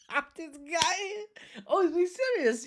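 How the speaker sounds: very high, drawn-out incredulous squeal; high-pitched laughing reaction; laughing disbelief. Retold or imitated. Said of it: "made me outright laugh out loud".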